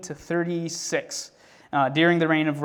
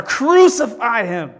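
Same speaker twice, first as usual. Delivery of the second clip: very loud, high, stretched-out shout; full-voiced crowd roar, each syllable drawn out; mob roar; 7 semitones higher, 9 dB louder, slower than usual. A narrator's reading. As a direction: roar it slowly as a furious mob. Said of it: "the mob roared even louder".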